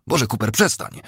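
urgent, frightened exclamation; alarmed plea. Acted. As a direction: beg him to stop, aghast. Judith cries out during the beating.

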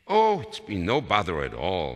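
loud, high polite reassurance; gracious neighbour. A narrator's reading. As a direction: brush off the apology effusively. Neighbour politely dismisses apology.

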